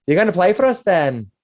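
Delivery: chirpy tavern landlady voice; bubbly barmaid. Acted. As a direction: greet the bard like a delighted hostess.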